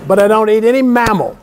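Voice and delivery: ordinary delivery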